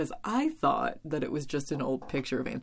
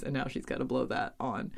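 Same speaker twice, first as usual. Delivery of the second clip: normal talking voice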